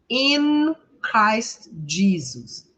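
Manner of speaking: "In Christ Jesus" very slowly, word by word; drawn-out "In Christ Jesus"; slow model phrase. Shown as a demonstration. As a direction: stretch each word for learners to repeat. Teacher models phrase for repetition.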